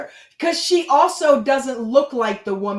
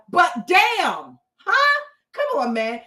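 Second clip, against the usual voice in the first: indignant challenge; 6 semitones higher, 4 dB louder, slower than usual. Real, not acted.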